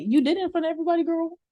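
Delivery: high, fast sassy callout. Retold or imitated. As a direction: call her out quickly. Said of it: "Mistress brought it up is just like".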